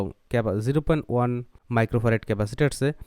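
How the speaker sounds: normal talking voice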